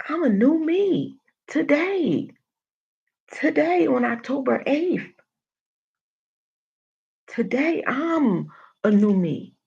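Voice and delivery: building, fired-up preaching voice; rousing declaration. Real, not acted. Rising empowered declaration.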